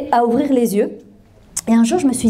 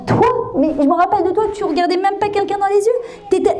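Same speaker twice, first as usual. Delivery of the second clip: higher-pitched incredulous teacher; retold disbelief; 6 semitones higher than usual. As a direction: act out a teacher's mild astonishment. Teacher disbelieves shy former pupil.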